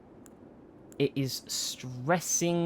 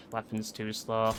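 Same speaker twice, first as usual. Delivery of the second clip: softer, low muttered complaint; said low, mostly to himself; quiet grumble; 4 semitones lower, 7 dB quieter than usual. Real, not acted. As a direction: grumble it under your breath. Muttered complaint, then repeated.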